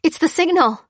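high, loud excited cry. A narrator's reading. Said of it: "she cried".

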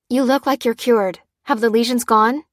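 usual speaking voice

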